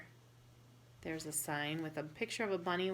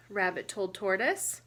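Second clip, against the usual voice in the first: ordinary delivery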